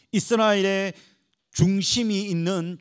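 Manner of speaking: ordinary talk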